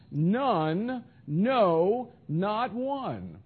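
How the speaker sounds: "None," "no," "not one" each set apart; three emphatic negatives. Shown as a demonstration. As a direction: count off None, no, not one as separate blows. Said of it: "you got three negatives".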